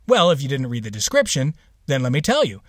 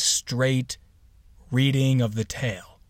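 ordinary delivery

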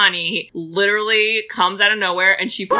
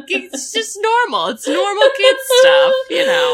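mock outraged exclamation; 4 dB louder than usual. Mock defending the kids' oddness.

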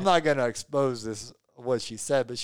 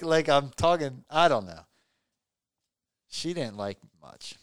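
ordinary talk, nothing marked

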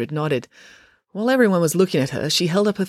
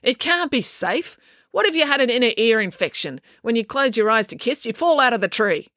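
worked up, high and urgent; impassioned speech; 8 semitones higher than usual. A narrator's reading. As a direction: rant on, growing flustered. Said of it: "delivering this impassioned speech".

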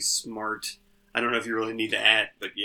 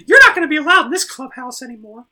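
very high falsetto character voice; squeaky cartoon voice; 12 semitones higher, 11 dB louder than usual. Cartoon mascot at an intervention for Jim, chiding in a squeaky falsetto.

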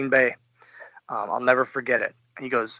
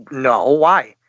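louder, puzzled protest; retold protest; 10 dB louder than usual. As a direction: push back, confused and loud.

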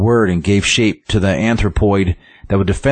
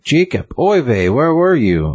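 put-on old lady voice; 7 semitones higher, slower than usual. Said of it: "there's an old lady and she's like".

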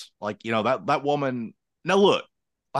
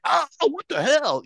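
high, slow, baffled dog; drawn-out stunned yelp of a dog; mock dog, pitched way up, asking what happened; confused dog voice; slower than usual. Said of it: "that dog was like".

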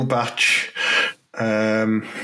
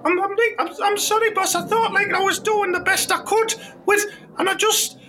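much higher squeaky player voice; timid young player. Acted. Player calling to his manager from the toilet, high timid voice. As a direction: answer the boss meekly from the toilet.

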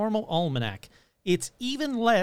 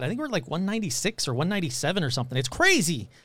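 ordinary talk

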